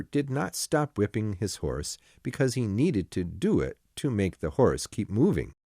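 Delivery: ordinary delivery, nothing marked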